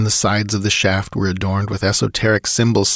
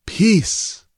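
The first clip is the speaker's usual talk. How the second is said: much higher-pitched ghostly command; ghostly tones; 12 semitones higher than usual. A narrator's reading. Ghostly sage silences Conan's protest, high and commanding. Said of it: "The ghostly tones reverberated".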